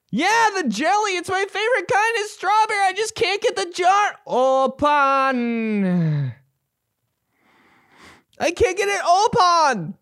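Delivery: high, strained rock singer croon; opening a stuck jar. Pretend. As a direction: whine about the jar like a ballad. Struggling with jar in voice.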